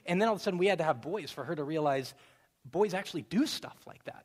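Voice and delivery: ordinary talk, nothing marked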